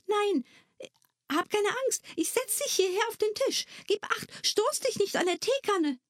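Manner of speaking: put-on girl calming a tiny creature, gently; gentle girl.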